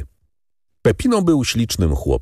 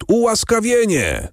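„ułaskawienie” called out much higher, rising over the crowd; crowd calling out. A narrator's reading. Crowd crying „ułaskawienie” at the execution, high call. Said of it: "zawołał lud".

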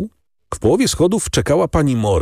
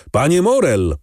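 a narrator's restrained shout from the stairs; calling out; 5 semitones higher than usual. A narrator's reading. Someone on the stairs calls Morel, calling out. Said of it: "krzyczał ktoś na schodach".

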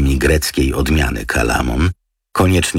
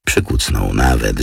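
usual speaking voice

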